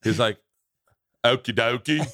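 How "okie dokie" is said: botched put-on British accent; failed London accent. Comedian attempts London doorman accent for laughing friend, botched. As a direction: try for London and miss. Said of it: "That's not it".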